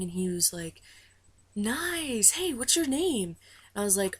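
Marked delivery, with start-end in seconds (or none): 1.6-3.4 higher, drawn-out mock hype-man call; imitating hype man. Tour guy with a microphone greeting a fan, imitated hype man. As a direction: put on an emcee's swooping voice.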